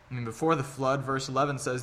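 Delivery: ordinary delivery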